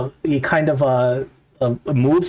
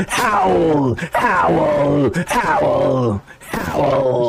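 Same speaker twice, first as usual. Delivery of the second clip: long, drawn-out falling howls; theatrical mad-dwarf howling; screamed howl; 3 semitones higher, much slower than usual. Acted. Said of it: "And he screams out".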